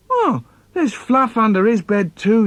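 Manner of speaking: put-on high-pitched childish objection; protesting youngest child. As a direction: protest the unfairness, pitched well up. Acted child protests to parents.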